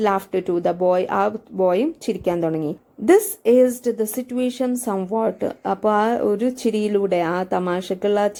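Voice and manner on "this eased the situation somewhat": reads the English text slowly, word by word; slow text reading. Acted. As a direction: spell it out slowly for the students. (പതുക്കെ വായിക്കുന്ന പാഠം)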